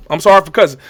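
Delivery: normal talking voice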